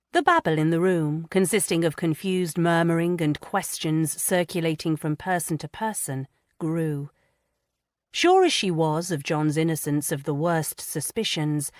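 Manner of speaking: usual speaking voice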